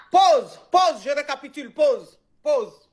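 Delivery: loud, insistent calls of pause to stop the story; emphatic call to pause. Real, not acted. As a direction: cut in firmly and loudly to halt everything. L'animatrice coupe pour récapituler. (appel fort et insistant à faire une pause)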